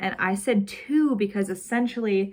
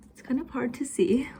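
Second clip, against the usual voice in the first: whispering; 5 semitones higher than usual. Real, not acted. Aside about a faint drawing.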